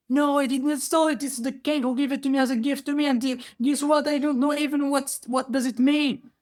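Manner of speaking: high-pitched, urgent denial; flustered poor man defending himself; protesting innocence. Acted.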